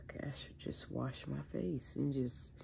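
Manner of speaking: breathy whispered self-talk. Real, not acted. Vlogger just woke up, whispering to herself. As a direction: whisper it to yourself, half awake. Muttering to herself after sigh.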